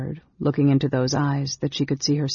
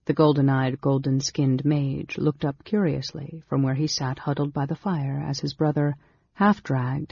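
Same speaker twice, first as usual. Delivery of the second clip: plain reading voice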